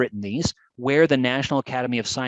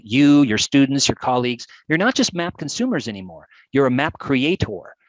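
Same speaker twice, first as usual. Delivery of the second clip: ordinary delivery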